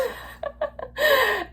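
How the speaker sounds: high-pitched laugh; real, high laugh between sentences. Real, not acted. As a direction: burst out laughing at yourself. Laughs at her own nervousness.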